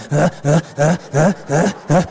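imitated hiccuping sobs, low and rhythmic; mock a kid catching breath after bawling; crying. Spanked child sobs on, low mock hiccups. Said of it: "¿Cómo te quedas llorando?".

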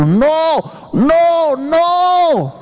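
high-pitched repeated no, hammed-up; mock divine shout. God shouting at the believer, mock high cry. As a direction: cry out no from above. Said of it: "Dios está gritando".

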